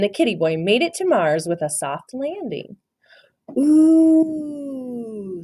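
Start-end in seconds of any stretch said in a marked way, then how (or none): 3.6-5.4 long, high, pleased ooh; contented sigh. A narrator's reading. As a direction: sigh with relief. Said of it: "sighed Don Diego".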